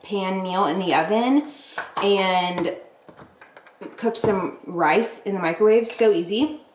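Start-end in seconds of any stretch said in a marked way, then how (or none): none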